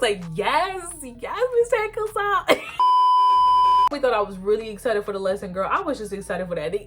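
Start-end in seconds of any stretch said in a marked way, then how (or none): 0.3-2.5 high, slow, overeager yes; eager student. Retold or imitated. Eager student answering Miss Hancock, high and overeager. 2.6-3.9 loud squealing giggle; giddy high laugh. Real, not acted. Girl recalling her crush, loud high giggle.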